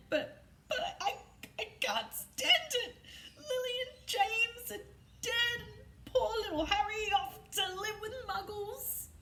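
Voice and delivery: put-on a big man crying over the baby; sobbing. A narrator's reading. Hagrid grieving to McGonagall over Lily and James, sobbing high. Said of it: "sobbed Hagrid".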